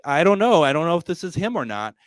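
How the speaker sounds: high, loud and rushed with nerves; flustered as if meeting a celebrity; starstruck fluster. Retold or imitated. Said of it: "I started to get, like, starstruck".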